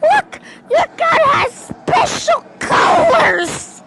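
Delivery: high, loud, slowed screaming wonder; excited scream. Retold or imitated. Boy screaming at friend about special colors, thrilled. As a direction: shriek at your friend's gun like it's magic. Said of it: "And I was like".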